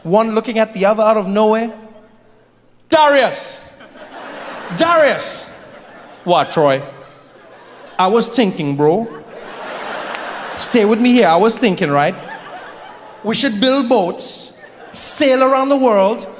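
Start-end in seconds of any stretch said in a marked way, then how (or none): 2.9-5.5 higher-pitched Caribbean call to a friend; comic Caribbean beach-guy hail. Acted. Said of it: "random handsome guys hanging out on a beach in the Caribbean". 6.2-6.8 low Caribbean reply; comic low-voiced Caribbean beach guy. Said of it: "random handsome guys hanging out on a beach in the Caribbean". 8.0-16.3 put-on comic Caribbean accent, spacing out the pitch; Caribbean beach guy with a big idea. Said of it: "random handsome guys hanging out on a beach in the Caribbean".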